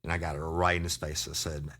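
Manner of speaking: ordinary delivery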